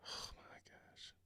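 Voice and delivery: breathy, drawn-out whisper; whispered dismay. Real, not acted. Streamer dreading the clip he must watch, whispered dismay. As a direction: sigh it out in a slow whisper.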